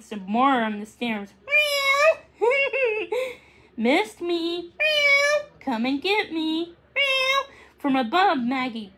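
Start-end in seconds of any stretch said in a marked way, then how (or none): 1.5-2.5 high, drawn-out crying meow; kitten meow. Acted. Max meows. 2.5-3.3 kitten giggle. Acted. Kitten laughs. 3.8-7.4 light, high cheeky kitten voice with meows; teasing kitten. Acted. Max teases Maggie.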